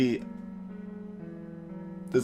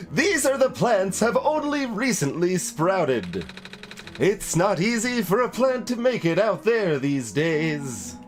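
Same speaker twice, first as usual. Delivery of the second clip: higher, louder theatrical plant character; talking plant holding forth with stretched words; potted plant voice; 8 semitones higher, 3 dB louder, slower than usual. Acted. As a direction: declaim as an animated potted plant. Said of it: "what's a voice for a potted plant".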